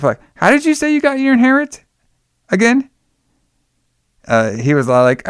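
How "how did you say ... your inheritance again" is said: high prying woman. Retold or imitated. Linda voiced high, suspicious.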